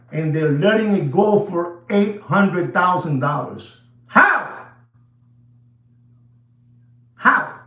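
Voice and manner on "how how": incredulous how. Real, not acted. Astonished at price drop.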